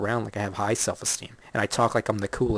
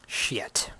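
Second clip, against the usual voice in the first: whispered regret; 5 dB quieter than usual. Retold or imitated.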